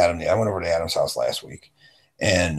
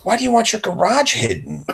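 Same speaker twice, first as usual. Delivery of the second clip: higher, baffled why question; raised-pitch incredulous question; 12 semitones higher than usual. Retold or imitated. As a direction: ask it in disbelief.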